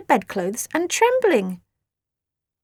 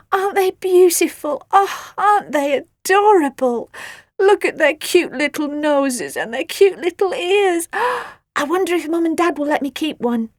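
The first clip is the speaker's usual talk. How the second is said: gushing; 6 semitones higher, 4 dB louder than usual. Acted. Gushing over puppies.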